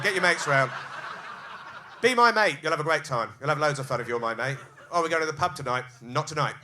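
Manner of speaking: hyped-up mate shouting his friends round; loud rally cry. Acted. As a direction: bellow it like a pub rallying shout. Recruiting mates, shouting.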